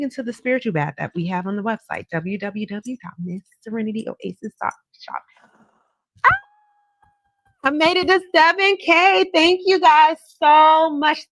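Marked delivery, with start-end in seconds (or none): 6.3-11.3 high, loud squeal of delight; thrilled shout of thanks; excited. Real, not acted. Excited at live milestone.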